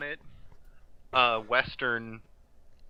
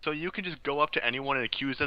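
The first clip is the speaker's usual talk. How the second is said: ordinary talk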